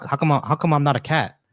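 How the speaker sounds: ordinary delivery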